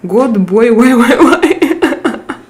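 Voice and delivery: laughing through the words, slow and drawn out. Real, not acted. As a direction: giggle as you ask why. Laughing over the chat name.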